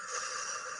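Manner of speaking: soft, breathy long exhale; sighing out the breath; deep-breath sigh. Shown as a demonstration. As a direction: release a deep breath audibly. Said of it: "Deep breath".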